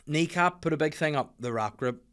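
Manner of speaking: normal talking voice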